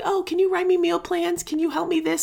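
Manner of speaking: high-pitched imitation of needy requests; high, mock-eager pleading; pestering askers. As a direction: mimic people asking favor after favor. Mimics people pestering her for help.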